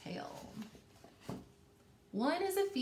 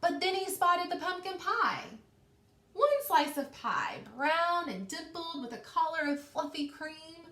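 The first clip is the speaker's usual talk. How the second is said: higher, building read-aloud voice; suspenseful story narration; 5 semitones higher, 4 dB louder than usual. A narrator's reading. Teacher reading the teetering tower to her class, building suspense.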